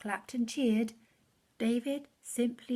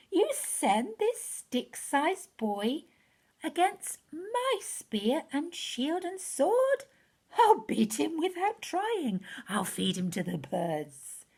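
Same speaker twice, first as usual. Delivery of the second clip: roaring giant; 6 semitones higher, 4 dB louder than usual. Acted. Goliath mocking the shepherd boy, hammed-up roar. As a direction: sneer it down at the boy like a bragging giant. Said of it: "He growled and cursed and roared".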